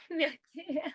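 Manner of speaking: high, loud laughing echo; amused, laughter breaking into the words. Real, not acted. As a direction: say it through a burst of laughter. Laughs while echoing the drink.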